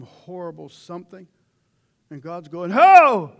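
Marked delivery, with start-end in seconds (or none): 2.7-3.3 a single huge, high bark of stop; hollering at a runaway horse; sudden halt shout. Retold or imitated. God yelling at someone heading for danger, sudden halt shout. God stopping you cold.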